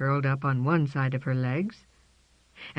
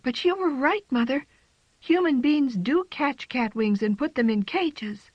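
put-on young cat voice; 10 semitones higher than usual. A narrator's reading. Jane speaking to mother.

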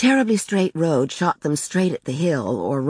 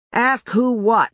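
slow, raised-pitch annoyance; distracted, testy husband; irritated growl; 7 semitones higher, 4 dB louder, slower than usual. A narrator's reading. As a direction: snap back in exasperation, drawn out. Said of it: "he growled".

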